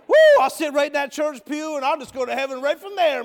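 mock shout. Mocking his old smug self.

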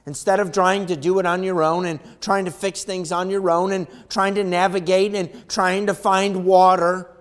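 loud, high fervent preacher; revival-style preaching; full-force preaching. Acted. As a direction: drive it home to the congregation.

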